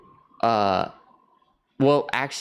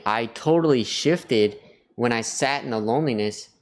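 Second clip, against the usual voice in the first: ordinary delivery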